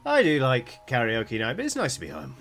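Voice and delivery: a monster sighing happily to be home; other Berts chatting. Acted. GM voicing Berts coming home, contented chatter. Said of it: "the other Berts being like".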